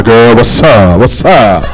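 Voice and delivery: low pickup line in a put-on voice.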